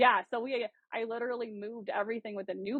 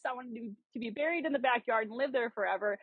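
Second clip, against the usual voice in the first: normal talking voice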